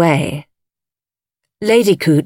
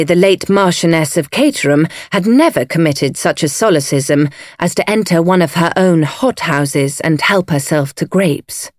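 ordinary delivery

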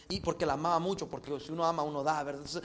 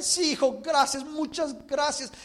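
mother's voice; 6 semitones higher, 6 dB louder, slower than usual. Acted. Mother answers son in joke.

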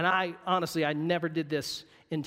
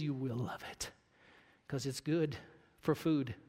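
quiet sober truth; 5 semitones lower, 7 dB quieter than usual. Acted. Preacher warning the congregation, hushed and grave. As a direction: drop low and much softer, grave.

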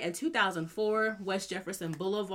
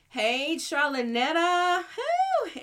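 excited greeting; 8 semitones higher, 4 dB louder, much slower than usual. Real, not acted. Greeting a listener in the chat.

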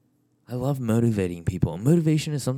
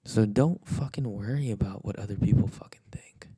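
whisper. Acted. Vlogger telling viewers they are loved, whispered. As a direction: breathe it like a secret.

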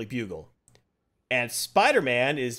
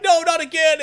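anguished cry; 8 dB louder than usual. Retold or imitated. Hero sees Gwen Stacy falling.

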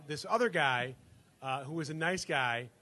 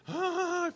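high-pitched imitated cry of panic; freaked-out mock scream; 14 semitones higher than usual. Imitating the roommate reacting to the intruder, freaking out. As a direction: give a high "ah" of comic alarm. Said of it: "freaking out".

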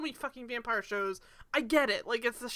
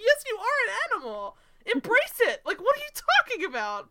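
mock scolding; 10 semitones higher, 4 dB louder than usual. Mock argument with the character.

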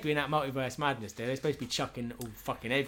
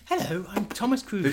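higher cheery greeting; mock doctor; 7 semitones higher than usual. Cruise as the doctor introducing himself, higher. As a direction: introduce yourself chirpily.

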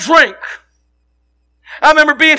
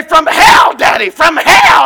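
put-on a boy calling back to his dad, loud and higher; eager boy's answer; 4 semitones higher, 6 dB louder, slower than usual. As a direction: proudly shout it like a young kid.

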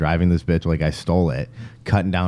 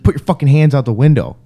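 louder, higher quoted order; retold armed command; 6 semitones higher, 6 dB louder than usual. Gunman ordering the driver, raised-voice command. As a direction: imitate a forceful order at gunpoint.

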